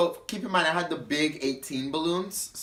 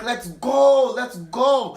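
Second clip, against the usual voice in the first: high loud whiny let's go; hammed-up drunk girl; drunk friend; 9 semitones higher, 7 dB louder, much slower than usual. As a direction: beg to leave, pitched up. Drunk friend urging to leave.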